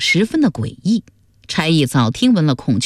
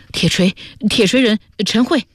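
out of breath, higher-pitched character voice; panting; 5 semitones higher than usual. A narrator's reading. Constable reporting to Ma after running over, out of breath. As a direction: stammer it out between puffs. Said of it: "气喘吁吁地说".

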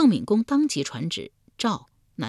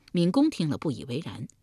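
plain reading voice

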